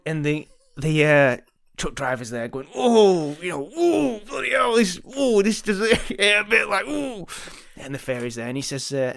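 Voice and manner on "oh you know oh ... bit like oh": high, loud, slow hammed-up moans of pleasure; mock ecstatic groaning, voice pitched way up; pleasured moaning. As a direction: ham up a man enjoying it far too much. Truck driver bent over, fairy behind.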